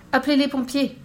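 high-pitched urgent call; exclaiming. A narrator's reading. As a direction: call out for help in mild alarm. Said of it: "s'exclama un autre".

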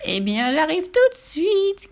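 high, slow gleeful witch; tickled witch's high cooing; clucking witch. Acted. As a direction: chirp it with drawn-out delight. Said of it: "gloussa-t-elle en se rétrécissant".

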